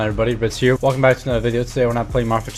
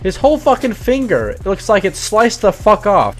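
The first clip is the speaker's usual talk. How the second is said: high, slow, appalled; shocked disbelief; 9 semitones higher, 3 dB louder, slower than usual. Real, not acted. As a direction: pitch jumps way up in shock, slowing down. Shocked by the sliced finger.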